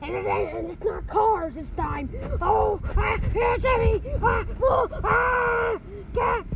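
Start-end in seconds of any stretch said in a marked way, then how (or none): 2.4-6.4 loud, high panicked screams; frantic yelling. Acted. Kids screaming while the wind carries them. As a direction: yell as you're swept up.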